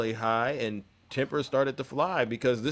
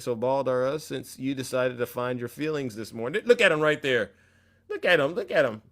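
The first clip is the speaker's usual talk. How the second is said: ordinary delivery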